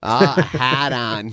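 slow, thick Boston "a hat on"; drawn-out Boston "Ah, a hat on"; Boston accent. Retold or imitated. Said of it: "thick Boston accent".

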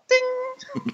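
very high little chime; ping it like a bell; high-pitched ding; imitated bell ding. Game bell sound, imitated.

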